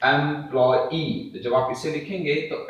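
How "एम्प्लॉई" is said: "एम्प्लॉई" said as एम-प्लॉय-ई; separate final ई. Shown as a demonstration. Teacher showing the sound that results, "एम्प्लॉई" with separate ई. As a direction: give "एम्प्लॉई" its own clear ई at the end. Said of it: "इस तरीके से ये sound निकल करके आएगा".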